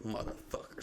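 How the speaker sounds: under-the-breath whispered swear; whispered curse. Retold or imitated. Dad cursing quietly beside his sleeping son, whispered. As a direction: mutter it so the kid won't hear. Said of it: "So I'm just like".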